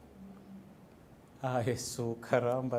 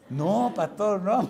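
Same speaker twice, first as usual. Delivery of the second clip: imitated protest; 6 semitones higher, 6 dB louder, much slower than usual. Brother urgently objecting, retold.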